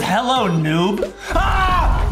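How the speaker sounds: ordinary delivery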